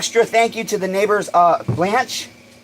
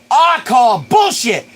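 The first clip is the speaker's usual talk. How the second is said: high, loud, giddy yelling; euphoric shout; 9 semitones higher, 3 dB louder than usual. Real, not acted. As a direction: shout your joy at the chat. Hyped by chat and sales.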